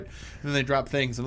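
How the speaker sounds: ordinary delivery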